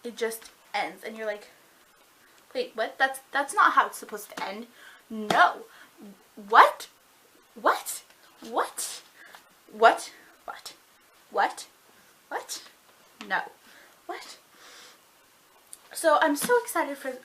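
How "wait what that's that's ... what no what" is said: stunned disbelief. Retold or imitated. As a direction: act out baffled refusal to accept it. Acting out shock at the ending.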